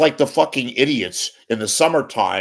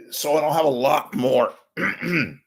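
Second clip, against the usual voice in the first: strained voice; 6 semitones higher, 5 dB louder than usual. Real, not acted. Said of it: "I sound like Robert F. Kennedy Junior".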